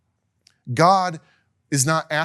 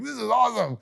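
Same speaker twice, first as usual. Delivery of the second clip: high-pitched, thrilled exclamation; mock excitement; 7 semitones higher than usual. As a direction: gush like someone on a big night out. Voicing excited temple visitor.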